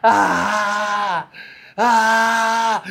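retold scream of a man clinging to a rock; mock desperate yell; imitated panicked scream. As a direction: voice the drowning man's cry in a put-on voice. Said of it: "abraçando e gritando".

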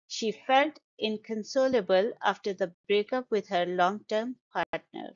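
usual speaking voice

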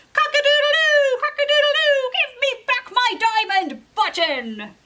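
high, loud rooster crow and shout; crowing rooster demand. Acted. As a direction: crow it out, then demand the button back. Rooster crows at the palace.

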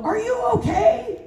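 high, drawn-out worried shout, acted out; alarmed call. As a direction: call out in mock panic to a fallen colleague. Acting out panicked line on set.

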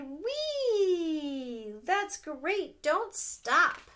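high, loud cheerful frog voice; frog's cheer. Acted. Frog cheering the dancing animals, high and loud. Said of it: "and the frog cried".

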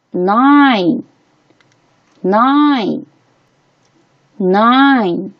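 nine with a long, drawn-out eye vowel; letter-name I sound. Shown as a demonstration. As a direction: say nine slowly, stretching the I. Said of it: "在这里发本声音I".

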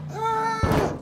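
very high-pitched mock scream. Teammates as the car charges Norval, high mock scream. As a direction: scream high, without real volume.

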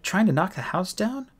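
high-pitched, lightly breathless question; panted. A narrator's reading. Juliet challenges Holly over the banging, mildly panting. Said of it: "she panted".

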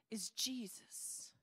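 slow, near-whispered ending; hushed reveal. Acted. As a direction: drop to a slow whisper for the key name. Hushed climax of Jesus's answer.

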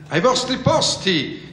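high, drawn-out imperious call; thundering command. A narrator's reading. Queen sends players to their places, thundering command. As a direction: call everyone to order, thundering. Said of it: "gridò la regina con voce tonante".

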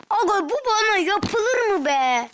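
high, loud disbelieving protest; outraged complaint. Real, not acted. Protesting to the game about being wronged. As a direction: cry out at the injustice.

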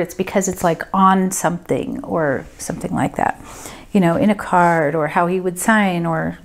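ordinary talk

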